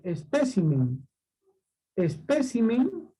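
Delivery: espécimen with the stress on PE, es-PE-ci-men. Shown as a demonstration. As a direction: stretch espécimen slowly, leaning on PE. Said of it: "esdrújula".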